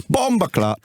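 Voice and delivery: high mock Jamaican exclamation; Jamaican patois imitation. Mimicking Philly's Jamaican curse at the defender. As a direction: fling it out in a Jamaican accent. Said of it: "Looks at you and just goes".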